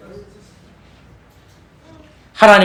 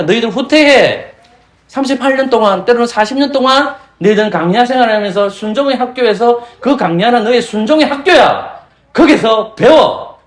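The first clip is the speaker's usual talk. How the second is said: God commanding retreat; 7 semitones higher, 3 dB louder than usual. Pretend. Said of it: "라고 하나님은 후퇴를 명령했어요".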